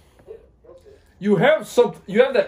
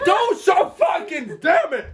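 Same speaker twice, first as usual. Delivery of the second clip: high, slow exasperated yell; frustrated shout; 12 semitones higher, slower than usual. Real, not acted. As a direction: blow up at the card. Frustrated outburst at card game.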